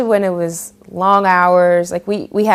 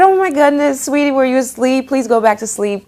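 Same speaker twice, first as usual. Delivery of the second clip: higher, gushing sweet caller; sweet fussing voice; 7 semitones higher than usual. Retold or imitated. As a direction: coo at someone you just woke. Caller fusses over waking her.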